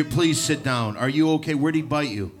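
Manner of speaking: usual speaking voice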